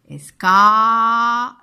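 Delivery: "Escar" with the A held long and loud; stretched vowel. Shown as a demonstration. Teacher spelling for pupils, "Escar" with a long loud A. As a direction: stretch the A in "Escar" loudly, leave off the R. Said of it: "j'entends A".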